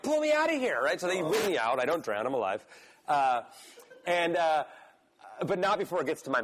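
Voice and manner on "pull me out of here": high panicked cry to be pulled out; freaked-out plea. Retold or imitated. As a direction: yelp it, retelling the panic, much higher. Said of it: "I, I freak out".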